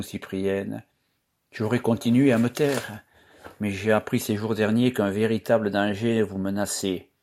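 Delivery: usual speaking voice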